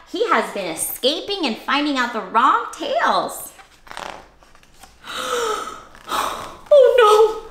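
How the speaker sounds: plain reading voice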